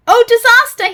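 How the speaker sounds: very loud, high panicked cry; frightened scream. Acted. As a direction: scream at the giant face. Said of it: "he screamed".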